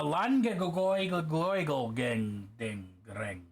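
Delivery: drawn-out gibberish imitation of Welsh; slow, tumbling fake-Welsh tongue twister; mock Welsh town name. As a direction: garble a very long Welsh place name slowly. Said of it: "That's my imitation of the longest".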